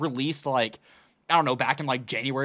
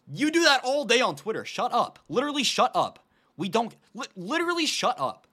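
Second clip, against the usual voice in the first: higher and louder, fed-up rant; exasperated shut up; 7 semitones higher, 4 dB louder than usual. Real, not acted. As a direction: tell them off, half-laughing in exasperation. Ranting at online hypocrites.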